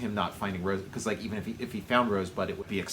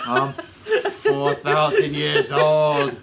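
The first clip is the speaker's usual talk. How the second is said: put-on slow, louder croaky old man; 4 semitones higher, 7 dB louder, much slower than usual. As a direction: drag it out like an ancient man. Plays old Charlie in a slow voice.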